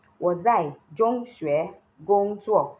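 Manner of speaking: slow, clear, each syllable apart; slow model sentence. Shown as a demonstration.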